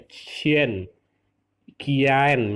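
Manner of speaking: "cien" dan "kian" diucapkan pelan dan jelas sebagai contoh; pinyin demo. Shown as a demonstration. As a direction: contohkan "cien" lalu "kian" seperti guru. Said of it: "kian dibaca cien". ("cien", "kian" dieja jelas untuk murid)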